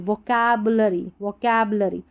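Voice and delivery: usual speaking voice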